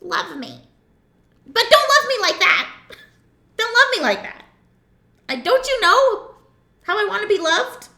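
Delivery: high, loud, laughing mock whine; women as cats. Woman teasing her partner in a high mock whine. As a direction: play a fussy cat who wants love her own way. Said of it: "We're like".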